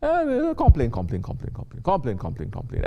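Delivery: nagging imitation of a chronic complainer; hammed-up whining on repeat; mock complaining. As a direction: rattle it off like endless grumbling. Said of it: "they're stuck at complaining".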